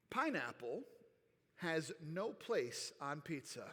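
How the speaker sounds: Pineapple said low and slow, has no place on pizza spaced out. Shown as a demonstration. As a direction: announce it low and deliberate, like a verdict. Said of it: "here we go".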